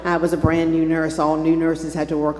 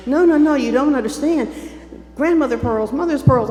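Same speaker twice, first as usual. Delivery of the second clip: high, loud, frantic pleading; replaying her hysterical plea to the flight attendant, high and loud; 7 semitones higher, 6 dB louder than usual. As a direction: beg the flight attendant in a panic. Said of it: "and I'm hysterical".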